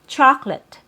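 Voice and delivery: "chocolate" as CHAHK-lit; no middle schwa in "chocolate"; two-syllable chocolate. Shown as a demonstration. Teacher naming the word learners will hear, "chocolate" as CHAHK-lit. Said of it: "we don't have three syllables".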